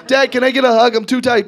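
high, loud plea of a tired son; pleading kid. Acted. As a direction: beg dad like an exhausted kid. Tired son pleading with his dad.

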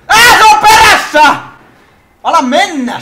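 loud, high panicked scream; terrified shout. Real, not acted. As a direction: shriek as it chases you. Chased in game. (kauhistunut huuto)

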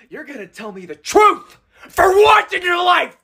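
high, loud crying demand; crying shout. Acted. Demanding the truth from the barista, crying shout. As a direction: sob and yell for the truth.

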